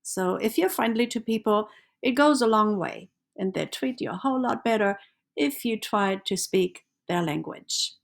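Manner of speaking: ordinary delivery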